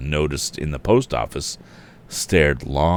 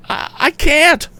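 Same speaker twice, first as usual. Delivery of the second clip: high, slow stammering fear; frightened; 3 dB louder, slower than usual. A narrator's reading. The frightened woman answering Tom, high faltering fear. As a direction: falter it out in panic. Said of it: "she answered frightenedly".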